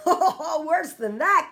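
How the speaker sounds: a giant roaring with laughter. A narrator's reading. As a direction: boom it out through big laughs. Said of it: "roaring with laughter".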